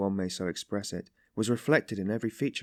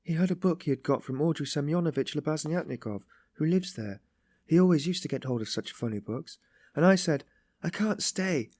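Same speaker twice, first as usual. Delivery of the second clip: put-on higher-pitched young woman's story voice; confessing Sonia, distressed; 5 semitones higher than usual. Sonia recalls refusing to read to father, regretful.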